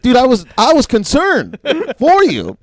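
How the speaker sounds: high, loud, pleading mock protest; protesting innocence. Acted protest of innocence to heckler.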